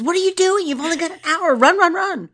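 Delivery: high, loud urging to run; high excited run run run; urgent attendant. Retold or imitated. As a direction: hurry him out, high and pushy. Attendant urging him to flee.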